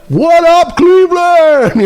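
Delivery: high, loud, slow "What up, Cleveland?", stretched out; hyped host greeting. Retold or imitated. Comic imitating a host pandering to a crowd, with a loud, high hype call. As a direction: pump up the crowd like an arena emcee. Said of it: "When you're like".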